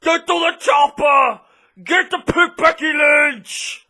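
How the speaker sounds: Arnold Schwarzenegger. Retold or imitated. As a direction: yell it like the action hero. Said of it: "She sounds like Arnold Schwarzenegger".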